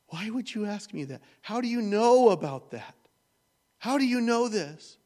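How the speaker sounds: high, loud, anguished pleading; a hurt teen demanding how he knows her secret; distraught cry. Retold or imitated. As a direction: cry out the questions in disbelief, almost wailing. Daughter confronted again, then tears.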